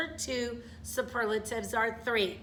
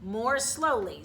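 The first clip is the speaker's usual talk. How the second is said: "More" slow and leaned on, then "slowly"; stress on MORE. Shown as a demonstration. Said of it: "so I'm gonna use more".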